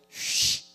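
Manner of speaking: 쉬 said as a long hushed sh, whispered; sounding out words. Shown as a demonstration. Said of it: "한번 구분해 볼까요?".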